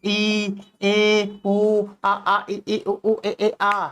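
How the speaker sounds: evenly spaced, held vowel sounds, schoolroom recital; reciting Hindi vowels. Shown as a demonstration. Teacher reciting Hindi swar for students, chanted vowel drill. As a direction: chant the vowel letters on one level note. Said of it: "जैसे कि हम लिखते हैं ना स्वर ... बोलते हैं ना".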